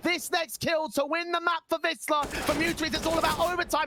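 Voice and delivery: climbing high-pitched play-by-play call; esports caster. Real, not acted. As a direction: build the hype to the final kill. Live play-by-play of clutch.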